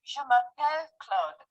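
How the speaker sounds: Je m'appelle Claude said slowly, each sound clear; slow careful French demo; slow teaching French. Shown as a demonstration. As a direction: drill it slowly for the student. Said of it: "let's try it again".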